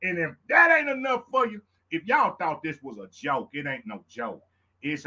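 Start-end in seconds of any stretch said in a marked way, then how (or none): 0.0-1.6 hype shout. Real, not acted. Hyping the shows.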